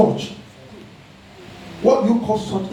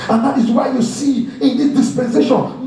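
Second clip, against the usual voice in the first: sustained, emphatic sermon delivery; forceful preaching; 5 semitones higher than usual. Real, not acted. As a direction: drive the teaching home to the congregation.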